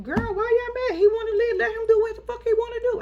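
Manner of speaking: high mock sister shrug; sister's dismissive voice. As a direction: brush it off in a high voice. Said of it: "the sister was like".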